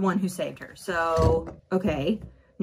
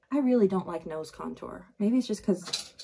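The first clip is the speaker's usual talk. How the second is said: normal talking voice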